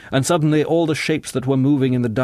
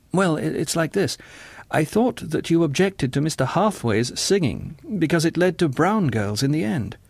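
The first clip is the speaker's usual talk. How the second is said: hesitant, earnest John; 6 semitones higher than usual. A narrator's reading.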